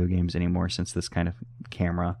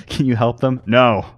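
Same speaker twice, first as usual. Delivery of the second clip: louder, punchy question and answer; loud mock exchange; 5 semitones higher, 9 dB louder than usual. Player voicing the creature's refusal, loudly. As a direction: bark the answer no. Reading dialogue choice aloud.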